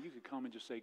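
ordinary delivery

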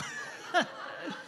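a short unvoiced chuckle; real amused laugh; breathy laugh. Real, not acted. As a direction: laugh quietly at the joke. Laughs at own joke.

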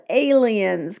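usual speaking voice

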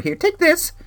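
put-on very high, sweet baby-talk voice; a sing-song cutesy voice handing over a little camera. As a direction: coo it like talking to a toy. Handing toy accessory, cutesy voice.

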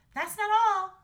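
put-on high, loud girl's interjection; eager Astrid. A narrator's reading. Daughter speaking up for the dragon, high and eager. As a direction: cut in urgently, pitched high. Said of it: "said Astrid".

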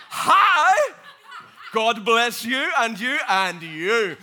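very loud high hi, then slow blessing; loud laughing greeting. Real, not acted. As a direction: call it out laughing to the crowd.

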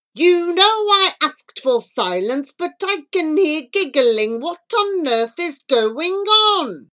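loud, high, slow telling-off; angry teacher. A narrator's reading. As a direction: scold the giggling class slowly. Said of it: "Whenever Mister Wind was angry".

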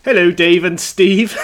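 slow, broad West Country voice; West Country accent. Pretend. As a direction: read it like a West Country farmer. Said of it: "read out in a West Country accent".

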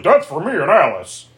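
slightly high, whiny retold objection; lightly voiced possessive boyfriend; mock boyfriend protest. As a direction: imitate a petulant claim. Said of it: "And Dan's like".

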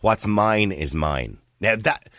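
normal talking voice

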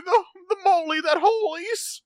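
very high, hammed-up cartoon hedgehog squeak; Sonic impression. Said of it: "you made Carl sound like Sonic".

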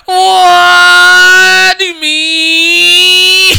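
very high, hugely stretched comic shout; exaggerated wail. Co-host showing off his good one, high drawn-out wail. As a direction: draw each word out into a loud wail. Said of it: "No, I've got a good one".

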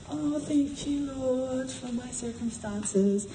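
soft, slow, high whimpering prayer; mock a feeble self-pitying pray-er; meek pity party. Preacher mocks a meek prisoner's prayer, soft and slow. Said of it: "weren't having a mild and meek pity party".

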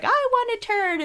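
high-pitched mock begging; put-on high voice; Rachel. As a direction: imitate an eager plea up high. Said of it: "And then Rachel's like".

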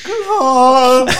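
high, loud hammed-up call; mock anguished shout. Retelling Phil hearing the gunshot, mock shout. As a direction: cry out the name like a soap brother. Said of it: "Phil goes".

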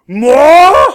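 huge loud high furious bellow; outraged roar. A narrator's reading. Red-faced master roars at the orphan, furious. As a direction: explode with rage.

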